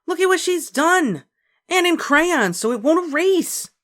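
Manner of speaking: high, loud outraged complaint. A narrator's reading. Beezus complaining to mother, loud and outraged. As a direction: tattle on the sister, furious. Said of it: "cried Beezus".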